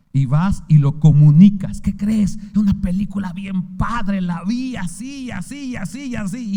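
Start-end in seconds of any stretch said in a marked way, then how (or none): 1.8-6.5 gushing fan. Retold or imitated. Mimicking someone gushing about a movie.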